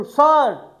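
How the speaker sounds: sharp, high and loud "सर"; high pitched call. Shown as a demonstration. Teacher shows a student's high pitched shout in class. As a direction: squeak "सर" out high and piercing. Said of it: "ऐसे आवाज़ देते हो".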